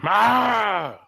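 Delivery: loud, high battle yell. Pretend. Player's dwarf charges up to the vampire, yelling. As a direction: let out a high "Ah!" yell at the enemy. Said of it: "And I'll yell at him".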